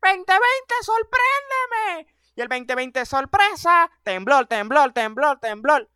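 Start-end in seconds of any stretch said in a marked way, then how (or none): 0.0-2.1 mock shout. A guy daring the new year, high mocking shout. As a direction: dare the year to surprise you. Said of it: "gritó".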